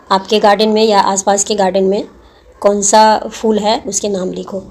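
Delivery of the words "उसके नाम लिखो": usual speaking voice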